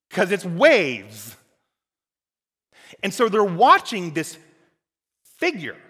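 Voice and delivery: loud, driving sermon delivery; full-force preaching. Acted. Preacher to congregation, loud full-force preaching. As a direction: preach it out big to the room. Preaching about the disciples at sea.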